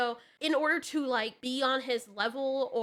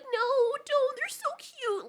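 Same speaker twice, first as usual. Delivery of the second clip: very high, drawn-out cutesy plea; high-pitched mock begging; mock pleading; 13 semitones higher, slower than usual. Acts women pleading for animals.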